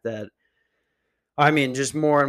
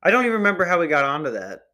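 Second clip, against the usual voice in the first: ordinary delivery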